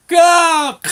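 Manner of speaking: short, very high, loud strangled yell; crash yelp. Retold or imitated. Father crashing in the fog, high yelp. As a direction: yelp like someone hitting a wall.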